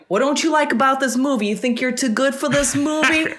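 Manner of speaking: mock-aggressive Boston accent like a Dunkin' Donuts sketch. Podcast host goading her co-host over a movie, hammed-up Boston accent. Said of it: "I should do the Boston thing and be like".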